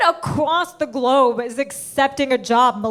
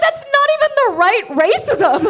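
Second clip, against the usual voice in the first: incredulous laugh; 9 semitones higher, 5 dB louder than usual. Retold or imitated.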